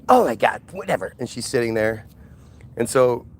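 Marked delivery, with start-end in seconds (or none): 0.1-1.2 imitating a huffy brush-off; mock exasperation. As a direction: act out an annoyed "whatever". Acting out the annoyed woman.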